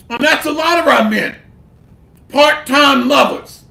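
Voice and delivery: ordinary talk, nothing marked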